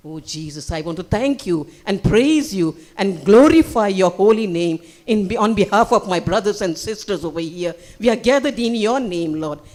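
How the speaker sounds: loud, impassioned praying aloud; fervent prayer. Real, not acted. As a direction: pray with force on behalf of the congregation. Prayer at raised force.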